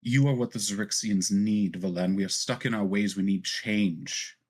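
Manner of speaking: controlled indignation, key words driven home; impassioned plea with a touch of fury; indignant fury. A narrator's reading. Zadali urges Valen that her people need change, indignant. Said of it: "You can feel the indignation, the fury".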